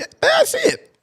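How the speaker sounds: very high mock fan voice; fans agreeing. Imagined fans agreeing in a very high voice. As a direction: squeak it out like fans. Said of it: "They're gonna be like".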